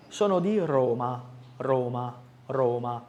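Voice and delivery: Roma with a tapped R; tapped Italian R. Shown as a demonstration. Teacher drilling the Italian R in Roma. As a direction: repeat Roma rolling the R. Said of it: "característica da pronúncia italiana".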